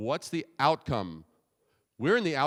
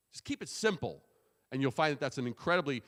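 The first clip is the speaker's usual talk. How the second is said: normal talking voice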